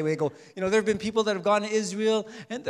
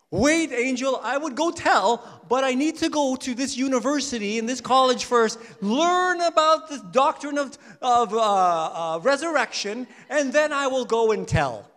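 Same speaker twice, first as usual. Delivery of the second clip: higher and louder, pleading excuses; hammed-up stalling to get out of going; mock pleading excuse; 6 semitones higher, 8 dB louder than usual. A woman answering the angel, hammed-up pleading excuse. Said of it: "the women answering the angel".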